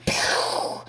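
unpitched, airy "pow" gun noise; imitated gunshot. Lady Shrek fires her gun, mouthed bang. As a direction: puff out a mouth-made gunshot.

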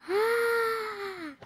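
high shocked shriek; short high yelp. Real, not acted. Shocked shout.

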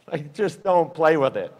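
ordinary delivery

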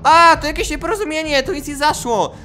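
caught culprit's excuse. Acted. Culprit explaining to police, high mock innocence.

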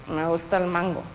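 "Me gusta el mango" said low, soft and flat, reluctant; displeased. Shown as a demonstration. Teacher showing liking said with displeasure, grudging and flat. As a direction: say "Me gusta el mango" as if you hate mango. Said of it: "con cara de desagrado".

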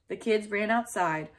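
ordinary delivery

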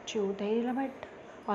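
ordinary delivery

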